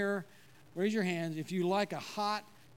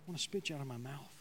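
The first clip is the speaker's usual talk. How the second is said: low, fast and much softer; hushed repeat; 6 semitones lower, 9 dB quieter, much faster than usual. Acted. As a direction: let it drop to a quick hush. God's words, repeated hushed.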